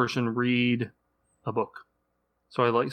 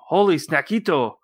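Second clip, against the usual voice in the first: lively cartoon-cat exclamation, higher; exclaiming kitty boy; 4 semitones higher, 4 dB louder than usual. Dad reading picture book to co-hosts, excited kitty voice. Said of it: "exclaimed the kitty boy".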